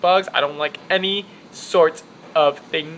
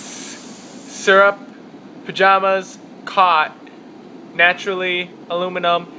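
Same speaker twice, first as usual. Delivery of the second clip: ordinary delivery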